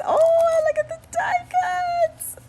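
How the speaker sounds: high, loud, thrilled shriek; excited shriek. Real, not acted. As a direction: shriek with joy over the gift. Excited over die cuts.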